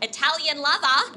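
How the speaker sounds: loud, high Australian accent. Shown as a demonstration. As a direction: blare it in broad Australian accent. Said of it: "say it with my Australian accent".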